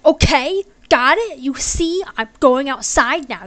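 loud, high, hammed-up character voice; exaggerated pushy announcement; mock insistent. Hammed-up reading of character's line.

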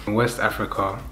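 ordinary talk, nothing marked

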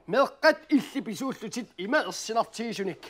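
usual speaking voice

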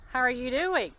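ordinary delivery

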